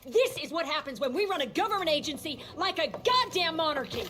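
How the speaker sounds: angry rebuke. Acted. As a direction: tear into him for breaking protocol. Heated argument about the institute.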